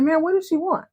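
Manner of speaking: high, impatient pestering. Retold or imitated. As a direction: press him for the answer. Karen pestering Joe again.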